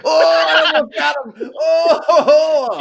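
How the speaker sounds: high, loud cry of near victory; real gaming excitement; excited shout. Real, not acted. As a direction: yell it as you almost win.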